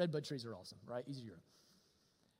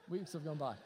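softer repeat, weeks leaned on; quiet echo; 5 semitones lower, 6 dB quieter than usual. Shown as a demonstration. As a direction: let it sink in softly. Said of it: "Remember, weeks have gone by".

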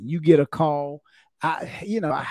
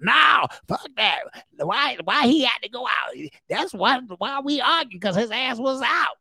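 higher, defiant complaining voice; arguing partner; 7 semitones higher than usual. Retold or imitated. Partner refusing officer's advice, higher defiant complaint. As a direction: push back at the officer.

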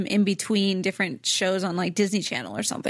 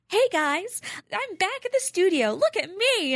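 put-on high, bubbly teen host voice; Disney Channel starlet voice; 11 semitones higher than usual. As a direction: gush like a peppy kid TV star. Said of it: "that's your Disney Channel starlet voice".

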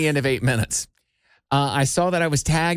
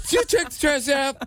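high-pitched mimicry of a nagging woman; mocking imitation; 9 semitones higher than usual. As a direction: parrot her question back in a high voice. Mimicking her regular-Ann line.

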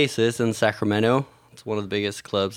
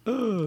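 annoyed groan; 10 semitones higher, 4 dB quieter than usual. Retold or imitated. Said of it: "you guys are like".

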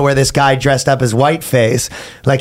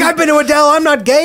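much higher, put-on squeaky voice; high mock voice; 12 semitones higher, faster than usual. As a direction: squeak it out like a caricature. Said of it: "It's like".

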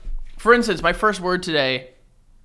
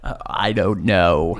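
low, slow groggy morning voice; deep just-woke-up rasp; 9 semitones lower, slower than usual. Real, not acted. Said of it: "what is your voice right now?".

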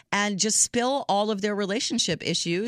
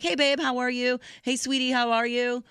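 higher, chirpy "Hey, babe"; breezy hello to everyone, pitched higher; breezy casual greeting; 6 semitones higher than usual. Retold or imitated. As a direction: toss off a quick hello to everyone. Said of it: "I call a lot of people babe".

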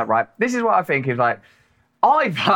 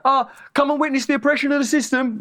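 higher pitched mock peasant from Holy Grail, indignant; a higher, indignant Pythonesque peasant complaining; Michael Palin; 9 semitones higher than usual. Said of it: "with Michael Palin".

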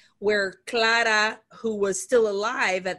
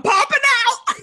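monster popping out; 7 dB louder than usual. Acted. Voicing the folded man appearing.

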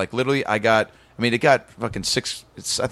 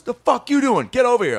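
high-pitched retold bark at a rookie; mock angry call; 11 semitones higher than usual. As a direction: imitate a boss calling someone out. Retold boss scolding new hire.

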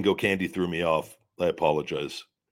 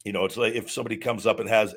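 ordinary talk

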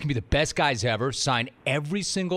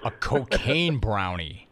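disbelief; 6 semitones lower, much slower than usual. Real, not acted. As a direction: say it like you can't believe it. Repeating in disbelief.

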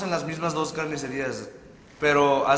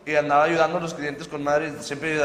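normal talking voice

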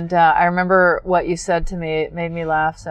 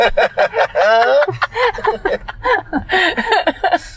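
big laugh; 12 semitones higher than usual. Real, not acted. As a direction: laugh hard at the memory. Laughing after the retold quote.

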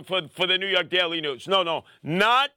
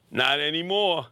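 low, dry, understated tough-guy line; cool comeback; 6 semitones lower than usual. Retold or imitated. Answering Malcolm X's daughter, cool and understated. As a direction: say it like the hero who just won.

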